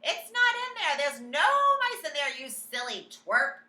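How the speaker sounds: loud scornful shout; mock him loudly; jeering cooks shouting. A narrator's reading. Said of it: "they were shouting".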